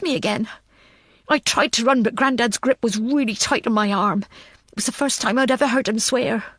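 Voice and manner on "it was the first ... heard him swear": plain reading voice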